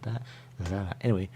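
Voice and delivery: usual speaking voice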